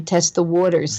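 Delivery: "test the waters" in a New Jersey accent, with "waters" said the Jersey way; New Jersey accent leaning into "waters". Real, not acted. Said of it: "I hear my own Jersey accent when I say".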